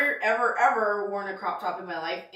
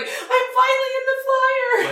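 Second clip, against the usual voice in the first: mock excited shopper; 4 dB louder than usual. Mocking a plus-size shopper.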